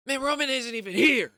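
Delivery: high, loud complaining shout; hollering that Roman is missing, hammed-up; mock frustrated yell. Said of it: "Jey Uso goes".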